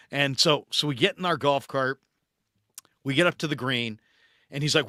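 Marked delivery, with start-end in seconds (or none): none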